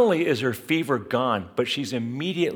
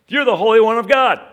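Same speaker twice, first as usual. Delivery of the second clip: much higher and louder, shrieking demon on God; 10 semitones higher, 11 dB louder than usual. Acted. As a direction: scream it out as the demon. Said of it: "this demonized guy speaks up".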